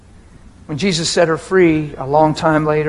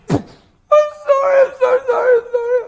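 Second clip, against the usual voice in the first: overwhelmed crying; 4 dB louder than usual. Retold or imitated. Husband imitating his wife running out crying, high overwhelmed wail. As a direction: squeal out a sobbing apology, barely able to talk. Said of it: "They're crying, and they can't hardly talk".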